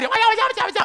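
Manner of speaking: high, insistent urging wife; nagging wife. Retold or imitated. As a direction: hurry him along, high and pushy. (istri mendesak dengan nada tinggi)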